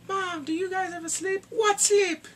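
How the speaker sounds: high, put-on sketch-comedy voices; skit impression. Skit family talking about sleep, high hammed-up comic voice. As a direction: squeal it like a scandalized sketch character. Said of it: "one part where he was like".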